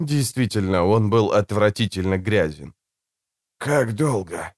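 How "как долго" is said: hoarse croak. A narrator's reading. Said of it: "прохрипел он".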